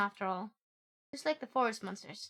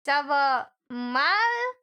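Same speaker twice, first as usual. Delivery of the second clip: slow, louder whiny ça va mal; mock crying French; 3 semitones higher, 6 dB louder, much slower than usual. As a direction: fake wail the question. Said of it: "mock French".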